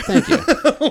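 mock-flattered thank you; mock grateful. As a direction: gush a hammed-up thanks. Mock gratitude.

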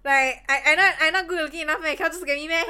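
high, loud, laughing mock sulk; laughing while imitating a jealous girlfriend; whiny jealous laugh. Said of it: "Then I'm like".

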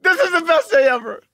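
high, loud, delighted outburst; gleeful exclamation. Real, not acted. Co-host crowing over a friend's mistake, gleeful. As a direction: crow it with real glee.

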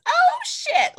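put-on high, loud sweet old lady squeak; little old lady voice. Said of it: "the little old lady Avon voice".

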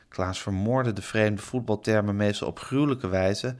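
usual speaking voice

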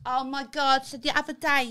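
mock Essex anecdote voice; Essex accent. Shown as a demonstration.

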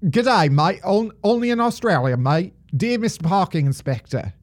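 hammed-up amateur Aussie accent; wandering, put-on Australian accent. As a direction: read it out as a broad mock Australian. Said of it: "that's probably the best my Australian accent has sounded".

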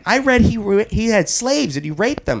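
mock whiny kid reciting facts; lightly voiced teenager; imitating the teen son. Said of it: "Anthony Junior is like".

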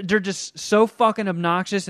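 normal talking voice

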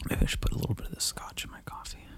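fast whispered aside; fast sneaky whisper. Real, not acted. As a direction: murmur a naughty idea.

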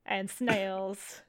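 hammed-up fake French accent, slow; a deliberately bad French accent for the cousin. GM voicing the French cousin in a bad French accent. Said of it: "I need to hear your French accent immediately".

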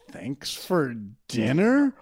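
slow, rising puzzled thanks; puzzled, drawn-out question. Retold or imitated. Driving home later, slowly puzzled over her line. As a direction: stretch it out, baffled. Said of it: "be like".

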